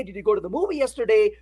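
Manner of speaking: the question rattled off fast; quick retelling. Retold or imitated. Recapping the friend's question to the class, fast. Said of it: "So when you say".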